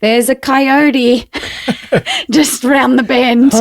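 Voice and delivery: high, drawn-out calling across a distance, amused; imitated distant yelling. Walkers warning dog owners from a distance, imitated yelling. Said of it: "people started yelling, 'cause we're social distancing, yelling across at us".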